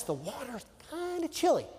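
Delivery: wry understatement. Real, not acted. Storyteller hinting at the cold lake, wry. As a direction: lift the voice into an understatement.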